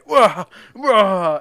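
high, loud pretend wailing; loud hammed-up whine; mock crybaby wail. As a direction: fake a tantrum cry. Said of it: "and then storming out".

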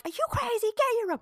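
high-pitched mock outrage; exasperated scolding. Mom retells scolding her son, exasperated.

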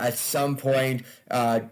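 normal talking voice

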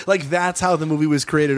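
ordinary delivery

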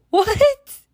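high laughing what; laughing disbelief. Real, not acted. Host laughing at the nurse's rudeness, high and squealing.

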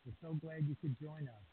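distant, faint voice off the microphone; speaking far from the microphone; far from mic. Real, not acted. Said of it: "You sound a little, a little far".

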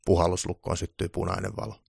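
ordinary delivery

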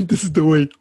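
loud, laughing mock Ugandan accent; Ugandan Knuckles meme. Host joining in on the meme joke, mock Ugandan accent. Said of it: "Ugandan knuckle memes".

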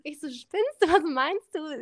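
high, loud retold disbelief; incredulous. Quoting herself reacting to the Hawaii idea, incredulous and high. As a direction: act out your own shocked reaction.

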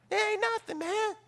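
high, swaggering brag; cocky brag. Retold or imitated. Proud soldiers brag to Joshua, high cocky swagger. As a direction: brush off the enemy with swagger.

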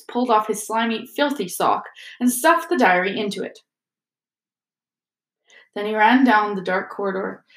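usual speaking voice

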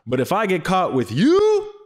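high, drawn-out dramatic outburst; overdramatic. Retold or imitated. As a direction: ham up the dire warning, pitch soaring. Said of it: "So dramatic".

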